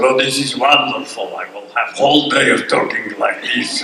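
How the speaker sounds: put-on Russian accent, relishing it. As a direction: play a delighted Russian. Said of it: "they said he was Russian".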